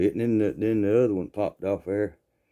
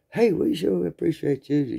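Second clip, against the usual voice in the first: put-on sweet girl voice; 8 semitones higher, slower than usual. Girl thanks them sweetly.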